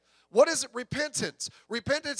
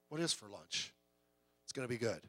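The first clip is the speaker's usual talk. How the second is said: much lower and softer "What is for lunch?" said to himself; quiet aside; 11 semitones lower, 14 dB quieter than usual. Real, not acted. Preacher admitting to the congregation he also wonders, quietly. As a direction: mutter it as a private joke. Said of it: "I'm with you".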